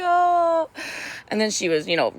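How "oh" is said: gushing oh. Retold or imitated. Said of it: "I was just like".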